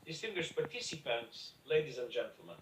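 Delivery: formally addressing royalty. Real, not acted. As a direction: greet the dignitaries with ceremony. Opening a formal speech to dignitaries.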